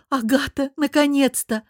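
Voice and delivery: high, excited greeting; delighted squeal. A narrator's reading. Lilya greets her returning friend, delighted. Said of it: "От её восторженных визгов".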